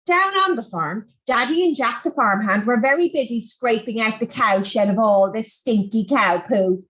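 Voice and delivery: ordinary delivery, nothing marked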